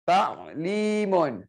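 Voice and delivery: slow, stretched middle syllable; drawn-out announcement. Shown as a demonstration. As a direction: draw the word out for the class. Announcing the word to look for.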